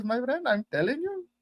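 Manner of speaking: put-on light Indian accent. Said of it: "that's a pretty good Indian accent".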